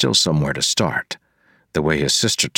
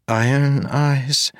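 put-on higher, careful young woman; 10 semitones higher, slower than usual. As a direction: ask gently as a young woman. Marasi asks, high female voice.

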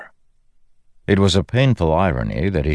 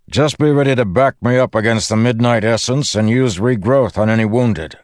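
high, raised voice; shouting; 7 semitones higher, 3 dB louder than usual. A narrator's reading. Windrunner briefing his companion before the fight, shouting. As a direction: call instructions across the wind.

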